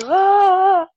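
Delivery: high sharp gasp of disbelief; a loud, high, delighted gasp; starstruck gasp. Real, not acted. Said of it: "I can barely talk".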